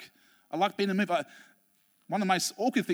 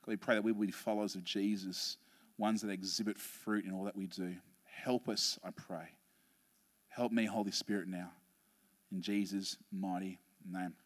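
soft, hushed prayer voice; a hushed pastor's prayer; 11 semitones lower, 11 dB quieter than usual. Acted. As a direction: pray it quietly, reverently. Opening prayer.

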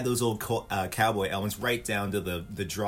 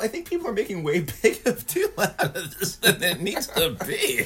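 laughing; 9 semitones higher than usual. Real, not acted. Laughing through a teasing remark.

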